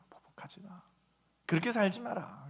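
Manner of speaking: very soft, whispered admonition; hushed command. Acted. Preacher voicing God's command to the congregation, hushed. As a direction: drop to a near-whisper.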